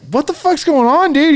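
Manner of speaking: high, incredulous retelling; retold alarmed question. Retells his alarmed question to friend.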